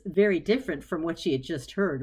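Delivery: ordinary delivery, nothing marked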